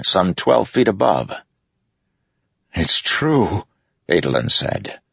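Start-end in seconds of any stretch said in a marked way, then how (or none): 2.7-3.6 higher, haunted realisation. A narrator's reading. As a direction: say it as if shaken by what you see. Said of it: "sounding haunted".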